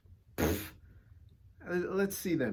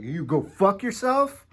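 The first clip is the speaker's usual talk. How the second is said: louder, higher, slower mock insult to a customer; rude waiter snap; 9 semitones higher, 14 dB louder, slower than usual. Mean restaurant waiter cursing at a diner, mock loud insult. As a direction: bark it like a nasty waiter. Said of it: "Or be like".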